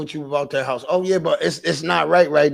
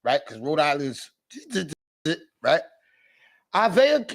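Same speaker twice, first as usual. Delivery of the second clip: usual speaking voice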